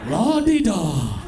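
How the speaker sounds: mock posh sing-song, slow; drawn-out posh la-di-da. Comic mocking Milton Keynes, slow mock posh voice.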